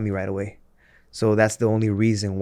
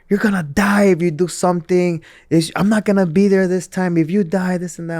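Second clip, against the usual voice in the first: higher and louder, mock scolding wife; retold angry wife; 8 semitones higher, 3 dB louder than usual. Wife scolding her sick husband, imitated angry scolding. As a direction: scold him like a furious wife. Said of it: "She got so mad".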